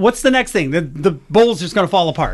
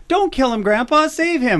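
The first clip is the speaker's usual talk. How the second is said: put-on higher pleading kid voice, lightly done; pleading grandson; 7 semitones higher than usual. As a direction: plead like a small grandson begging to save the spider. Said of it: "our grandson was like".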